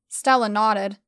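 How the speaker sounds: ordinary delivery, nothing marked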